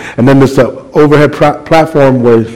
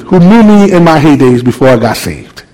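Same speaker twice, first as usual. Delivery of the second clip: ordinary talk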